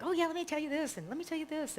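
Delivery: high, eager mock voice of a man; a lightly voiced excited friend; playful high-pitched impression; imitating him. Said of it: "He's like".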